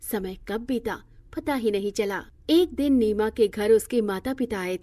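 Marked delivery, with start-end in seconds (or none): none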